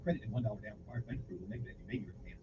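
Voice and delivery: rushed low fine-print read; fast disclaimer. Acted. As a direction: rattle off the legal small print.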